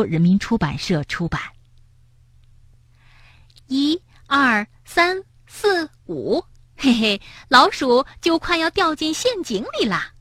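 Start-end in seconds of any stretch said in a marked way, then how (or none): 3.7-10.1 gleeful high counting, a touch teasing; playful counting. Acted. Rabbit counting squares as he plays, gleeful. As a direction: count the moves with glee.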